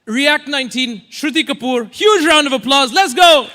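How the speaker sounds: high, loud MC announcement building to a shout; hype introduction. Real, not acted. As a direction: bring the speaker on with rising energy. MC introduces the speaker to applause.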